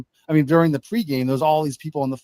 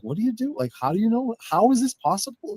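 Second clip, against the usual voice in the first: a high, disbelieving question, mildly imitated; incredulous brother; 8 semitones higher than usual. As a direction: lightly play the stunned brother asking how. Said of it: "my brother's like".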